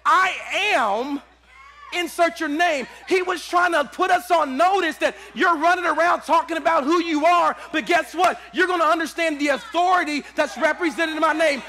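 loud, shouted sermon climax; full-force preaching. Acted. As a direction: preach it to the back row.

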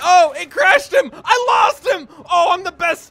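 high triumphant shouting. Real, not acted. Gamer losing the cops, high triumphant shout. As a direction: crow over the win. Winning chase.

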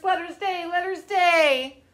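high pleading bear voices; begging bears. A narrator's reading. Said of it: "begged the bears".

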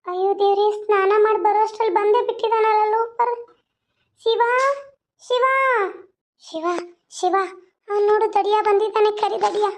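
high put-on girl's voice, fretting; a frightened village belle complaining about the loafer. Heroine's put-on voice.

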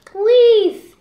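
high, very loud drawn-out plea; loud pleading. Real, not acted. Child begging mother not to leave, loud plea.

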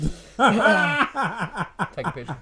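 drawn out through laughter; slow laughing. Real, not acted. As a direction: laugh while posing. Posing for photo, laughing.

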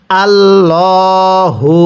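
slow drawn-out tajweed chant; Quran recitation. Shown as a demonstration. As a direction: stretch every vowel like a teacher reciting. Recites the verse.

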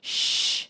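loud, sharp "shh"; loud retold shush; mock annoyed shush. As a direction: imitate a cross coworker shushing. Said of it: "would get really mad".

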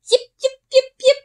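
high, sharp puppy yips; wolf pup howling at bear shenanigans. Acted. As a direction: yip like an excited wolf pup.

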